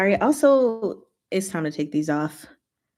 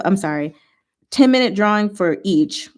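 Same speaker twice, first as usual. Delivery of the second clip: ordinary talk, nothing marked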